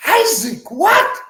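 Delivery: slow, high, baffled question; imitated double take, pitch jumping up; incredulous echo. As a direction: repeat the name back in disbelief. Director baffled by the real name.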